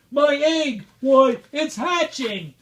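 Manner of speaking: high, loud thrilled cry; excited shout. A narrator's reading. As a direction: burst with joy as Horton. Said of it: "shouted Horton".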